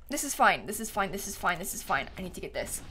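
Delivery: low, quiet repeated mutter; muttered self-reassurance. Real, not acted. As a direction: talk yourself down under your breath. Calming self after setback.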